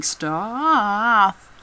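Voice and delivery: staff with a hugely stretched "ah"; exaggerated "staaahff", mocking own slip; self-correcting long vowel. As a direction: draw out the "ah" in staff, comically slow. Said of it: "I'd correct myself".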